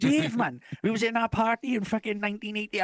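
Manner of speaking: lightly mimicked high-pitched old pal; over-familiar acquaintance. Acquaintance insisting he knows him, over-familiar. Same acquaintance jogging his memory.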